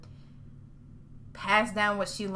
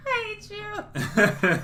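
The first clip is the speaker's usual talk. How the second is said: higher, drawn-out words breaking into laughs; laughing protest; 10 semitones higher, much slower than usual. Real, not acted. Co-host jokes about disconnected phones, laughing mock hatred. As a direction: say it through helpless laughter.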